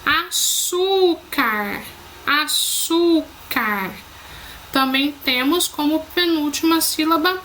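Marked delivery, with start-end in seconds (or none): 0.0-3.9 slow "Açúcar" with ÇÚ stretched long; teacher's slow demonstration of "Açúcar", stress on ÇÚ; stressed syllable drawn out. Shown as a demonstration. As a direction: draw out the middle syllable of "Açúcar" each time. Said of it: "Prestem atenção quando eu vou falando".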